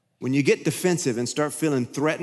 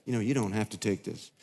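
low, soft, coaxing tempter; a hushed, wheedling devil on your shoulder; sympathetic devil; 4 semitones lower, 3 dB quieter than usual. Retold or imitated. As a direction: lower and softer, luring you in. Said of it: "The devil comes to be all sympathetic".

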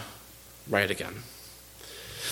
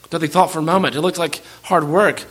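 usual speaking voice

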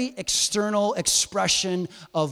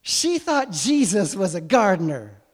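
louder, emphatic disbelief; incredulous; 3 semitones higher, 5 dB louder than usual. Real, not acted. Preacher repeating the verse to the congregation, loudly incredulous. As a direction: punch it out as if it's unbelievable.

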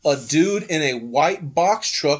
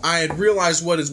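usual speaking voice

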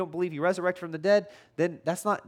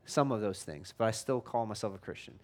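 lower, softer voice of a doubting listener; imitated objector; 7 semitones lower, 4 dB quieter than usual. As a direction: lightly play the unconvinced churchgoer, low and quiet. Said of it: "you're going".

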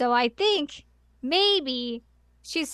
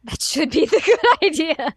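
louder, laughing through the quote; laughing mock repeat; 4 semitones higher, 6 dB louder than usual. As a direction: tease the line while giggling.

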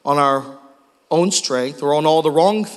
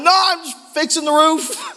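high, laughing through the words; nervous laugh; 10 semitones higher than usual. Retold or imitated. Pastor to neighbor while stuck on the roof, nervous laughing. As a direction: cover your fear with an awkward giggle. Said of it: "It's a weird laugh".

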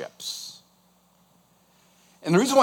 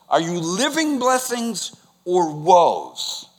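ordinary delivery

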